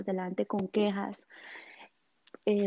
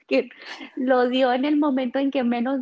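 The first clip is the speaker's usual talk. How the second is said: laughing; 3 semitones higher, 6 dB louder than usual. Real, not acted. Laughing at the memory.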